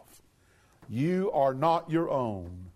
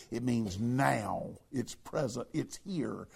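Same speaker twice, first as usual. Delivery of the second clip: ordinary talk, nothing marked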